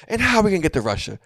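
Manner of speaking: mock exasperated rant, high and loud; annoyed. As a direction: act out an irritated leader losing patience. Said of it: "you can hear him getting annoyed".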